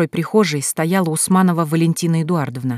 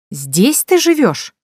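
high, louder, very drawn-out question; appalled disbelief; 6 semitones higher, 5 dB louder, much slower than usual. A narrator's reading. Sister looking round her brother's home, appalled.

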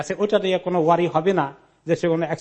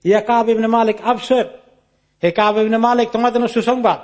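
higher-pitched mock shout of good news; imitated rooftop shout; 4 semitones higher, 3 dB louder than usual. Man on a rooftop shouting good news to Kab, imitated shout. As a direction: call it out as if from a rooftop. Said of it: "একটা লোক চিৎকার করে বলছে".

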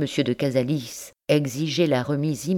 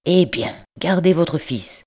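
louder, higher, curt; ton brusque; 4 semitones higher, 5 dB louder than usual. Acted. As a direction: give in grudgingly and curtly. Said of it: "d'un ton brusque". (l'oncle cède sèchement à sa nièce, ton brusque et fort)

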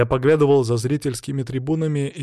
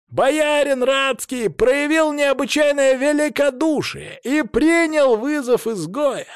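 herald shouting; 11 semitones higher, slower than usual. A narrator's reading. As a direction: announce to a packed arena. Herald proclaims to arena crowd.

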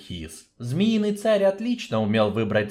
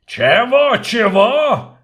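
high, loud outraged dwarf shout; yelling dwarf; 11 semitones higher, 9 dB louder, much slower than usual. Acted. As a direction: bellow it in disbelief as the dwarf. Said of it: "заорал гном".

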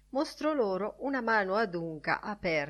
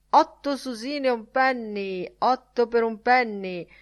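higher, louder hawker's call; street seller's cry; 6 semitones higher, 5 dB louder than usual. A narrator's reading. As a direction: call out wares to passers-by. Street seller's cry by Nelson's column.

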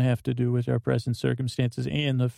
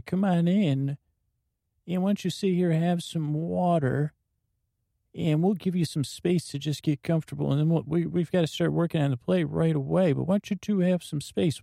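higher, hearty welcoming cowboy; cheery cowboy host; 5 semitones higher than usual. Acted. [name] welcomes the two newcomers, higher, cheery cowboy host. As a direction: greet guests like a jolly ranch hand.

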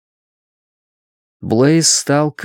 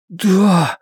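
higher, breathed-out answer; exhaled yes; 7 semitones higher than usual. A narrator's reading. Blaise answers Bluenote's offer, exhaling it. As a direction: let the word out on a breath. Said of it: "выдохнул Блейз".

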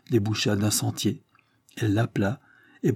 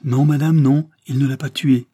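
higher and louder, insisting; emphatic denial; 5 semitones higher, 8 dB louder than usual. A narrator's reading. Bigrande denying to the mother, loud and insistent. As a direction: cut her off with a forceful no.

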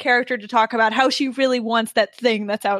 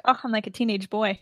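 normal talking voice

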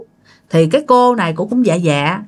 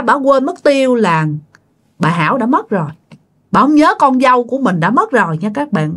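plain reading voice

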